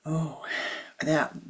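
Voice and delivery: usual speaking voice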